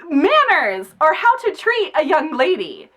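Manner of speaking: high-pitched indignant telling-off; mock-appalled lecturing; scolding mother. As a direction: scold them like naughty children. Retold inner scolding of boys.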